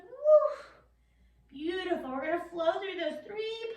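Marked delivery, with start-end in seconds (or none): 0.0-0.5 high, loud happy whoop; cheering whoop. Real, not acted. Yoga teacher cheering kids in downward dog. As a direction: cheer them on with a big woo. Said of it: "stretch out your back".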